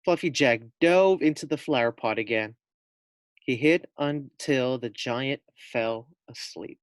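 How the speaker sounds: plain reading voice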